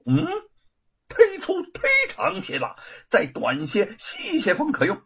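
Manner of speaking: high-pitched appraisal; Monkey King. A narrator's reading. Monkey King wanting the iron pillar smaller, high voice. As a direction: start very high, then settle into a request.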